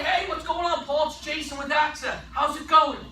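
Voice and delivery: high, upbeat cold-call greeting; bright, familiar phone opener; confident name intro. Shown as a demonstration. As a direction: say your name like an old friend calling. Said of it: "the way that I say my name".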